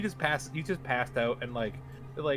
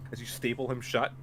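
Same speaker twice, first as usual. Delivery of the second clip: normal talking voice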